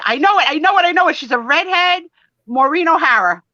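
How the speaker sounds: high, loud eager guessing; real excitement; excited guess. Real, not acted. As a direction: blurt it out like a quiz contestant. Eagerly guessing the magazine star.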